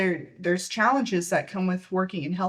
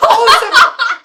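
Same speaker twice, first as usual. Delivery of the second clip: loud high laughing oh; 17 dB louder than usual. Real, not acted. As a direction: burst out laughing on it. Laughing hard at the joke.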